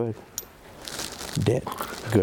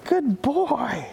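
much higher, jubilant praise for the dog; high excited praise; 12 semitones higher than usual. Real, not acted. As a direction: celebrate the breakthrough with the dog. Celebrating dog's success.

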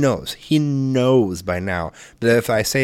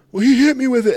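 put-on much higher, louder child excuse; whiny kid; 13 semitones higher, 5 dB louder than usual. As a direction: whine like a caught kid. Quoting son's excuse.

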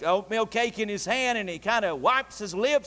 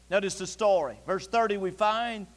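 ordinary talk, nothing marked

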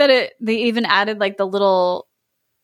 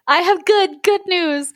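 higher, bubbling with good news; excited cry; 8 semitones higher than usual. A narrator's reading. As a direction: burst out with the news. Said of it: "she cried".

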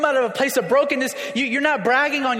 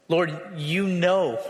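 low, slow surrender, far from self-certainty; humbled; 4 semitones lower, much slower than usual. Acted. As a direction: yield humbly, drawing out "you know". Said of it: "but on this side, there's".

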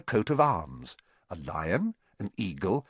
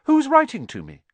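put-on high, loud boy's demand; urgent raised-voice question; insistent questioning; 7 dB louder than usual. A narrator's reading. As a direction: press hard for an answer. Boy presses uncle for answer.

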